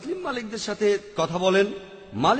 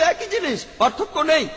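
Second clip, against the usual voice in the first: mock objector; 7 semitones higher than usual. Lecturer imitates people who call donation a bribe, high and mocking. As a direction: put on the voice of a sceptic brushing it off. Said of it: "এখন মানুষ হয়তো বলবে".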